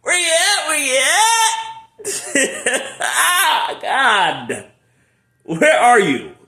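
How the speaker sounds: high, loud, drawn-out calling. Real, not acted. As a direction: call out to someone far away. Calling out to God.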